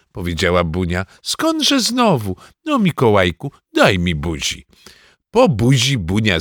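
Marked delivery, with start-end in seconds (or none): 1.2-4.7 granny voice gliding down from high; doting grandmother. Acted. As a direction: dismiss it airily, then coax the child. Grandmother denies, then coaxes grandson.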